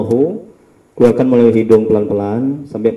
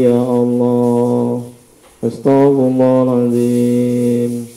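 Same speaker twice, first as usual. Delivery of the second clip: slow, drawn-out plea, each ya Allah stretched; leading a repentance prayer; much slower than usual. Acted. Healer voicing the patient's repentance to Allah, slow solemn prayer.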